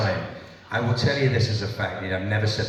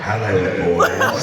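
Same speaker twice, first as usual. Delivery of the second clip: deep villain impression; 4 semitones lower, 5 dB louder, much slower than usual. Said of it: "Mark Bart comes on the show".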